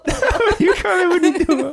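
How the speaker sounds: high, giggly mocking echo; laughing mock quote. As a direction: repeat the question while cracking up. Mocking cohost's question.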